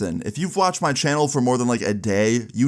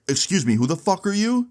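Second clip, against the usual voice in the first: mock indignant, higher-pitched retort; indignant challenge; 8 semitones higher than usual. Angry guy confronts the storyteller at a party, mock indignant. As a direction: imitate an affronted guy challenging a stranger.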